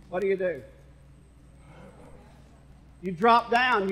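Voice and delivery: usual speaking voice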